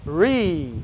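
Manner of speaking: "re" as a drawn-out English "ree"; isolated English R. Shown as a demonstration. English teacher isolating the R sound, slow "re" as "ree". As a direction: hold "re" slowly with a rounded R. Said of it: "e o R é".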